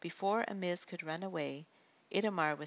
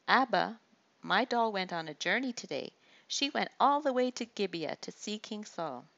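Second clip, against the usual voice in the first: put-on lightly higher, louder child's chatter; excited little girl; 4 semitones higher, 5 dB louder than usual. Little Rizpah greets her father, lightly childlike and eager. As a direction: bubble over like a little girl.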